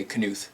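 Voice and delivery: "Knuth" with a sounded K; "Knuth" German-like, starting kuh-; K pronounced. Shown as a demonstration. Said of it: "if you say Knuth".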